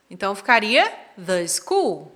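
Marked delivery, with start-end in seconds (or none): 1.2-2.1 "the school" as "thuh school"; short uh in "the" before "school". Shown as a demonstration. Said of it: "quando tem som de consoante".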